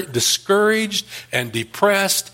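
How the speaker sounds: usual speaking voice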